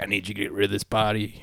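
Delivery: low-key, quiet order; a hint of a hushed, low-voiced crook; lightly voiced saloon boss. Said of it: "He's like".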